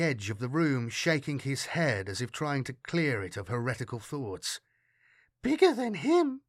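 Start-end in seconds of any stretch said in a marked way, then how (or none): 5.4-6.4 put-on high-pitched child voice; small child comparing, pitched well up; little girl. A narrator's reading. Girl sizes up Gunnar against her friend, high child voice.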